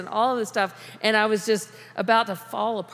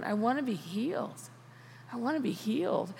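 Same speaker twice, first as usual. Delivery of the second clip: quiet plea; 9 dB quieter than usual. Acted. Blind man answering Jesus.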